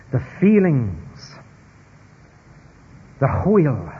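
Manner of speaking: hwyl, slow and carefully Welsh; Welsh word hwyl. Shown as a demonstration. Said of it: "if I've pronounced that right in the Welsh".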